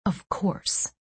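breathy stunned whisper. A narrator's reading. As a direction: whisper it to yourself. Repeated inner realization, whispered.

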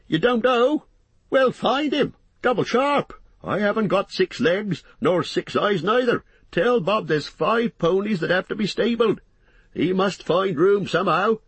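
high-pitched landlord giving orders; bossy, harried innkeeper. Acted. Landlord to servant about stabling ponies, harried orders.

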